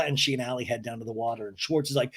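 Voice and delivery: ordinary delivery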